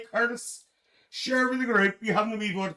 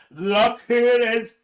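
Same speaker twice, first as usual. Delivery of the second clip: higher, louder repeat of the greeting; excited, louder welcome; delighted call; 7 semitones higher, 7 dB louder than usual. Real, not acted. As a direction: lift it the second time like a cheer. Greets a returning viewer, twice.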